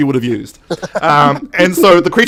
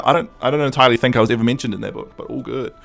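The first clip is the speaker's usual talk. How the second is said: ordinary talk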